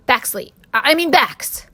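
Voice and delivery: put-on a small boy faking a grown-up voice; stammering boy. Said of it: "he stammered".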